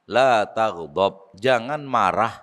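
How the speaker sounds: low, very slow and drawn out; measured counsel. Retold or imitated. The Prophet advises an impatient man, slow measured counsel. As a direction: give the advice slowly in a low voice.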